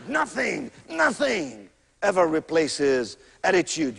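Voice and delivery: normal talking voice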